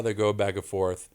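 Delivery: ordinary delivery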